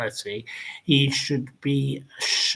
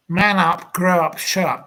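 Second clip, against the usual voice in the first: louder, higher barked orders; angry scolding; 4 semitones higher, 6 dB louder than usual. Acted. Caller berating [name], loud and angry. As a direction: tell him off hard.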